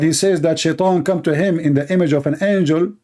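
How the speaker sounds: ordinary talk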